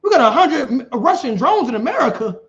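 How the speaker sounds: sharp high "What?" breaking into a laugh; shocked disbelief. Retold or imitated. Listener hearing about Russian drones, stunned laughing disbelief. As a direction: gasp it out, half laughing in disbelief. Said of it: "You be like".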